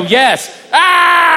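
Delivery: a yes then a high shouted cheer; comic answering a fan with a yes and a whoop; shouted agreement. Retold or imitated. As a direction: whoop back at the crowd. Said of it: "And I go".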